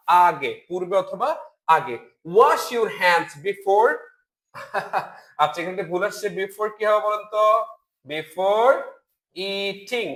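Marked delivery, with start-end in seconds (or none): 4.6-5.1 soft brief chuckle; short laugh. Real, not acted. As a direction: laugh softly at your own slip. Chuckles at own mistake. (নিজের ভুলে মৃদু হাসি)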